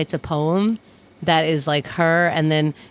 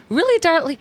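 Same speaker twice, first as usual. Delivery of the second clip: higher, drawn-up disbelief on "Really"; mock surprise; 10 semitones higher than usual. Hearing a TV star came out, higher mock surprise. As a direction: act mildly stunned by the news. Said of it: "this was my response".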